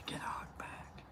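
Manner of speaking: hushed, voiceless whisper. Real, not acted. Host signing off to viewers about hugs, whispered. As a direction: barely breathe it out in a whisper. Soft sign-off line, very hushed.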